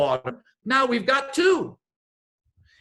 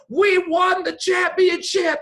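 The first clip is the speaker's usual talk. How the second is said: imitating a fan's yell, higher and louder, slowed; 10 semitones higher, 5 dB louder than usual. Fans celebrating a title they watched from a bar, shouted. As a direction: bellow it like a euphoric fan. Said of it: "They were going".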